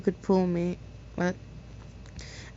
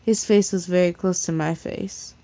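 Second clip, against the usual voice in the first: usual speaking voice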